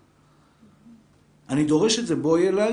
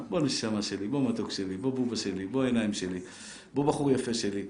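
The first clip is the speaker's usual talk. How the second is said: softer, doting endearments; tender parent; 6 semitones lower, 4 dB quieter than usual. Retold or imitated. Parent calling the child close, softly doting. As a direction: coo each pet name lovingly.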